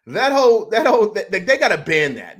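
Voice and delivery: loud, indignant demand, starting high; indignant outburst. Real, not acted. Fan demanding the tush push be banned, indignant. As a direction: burst out loudly in protest.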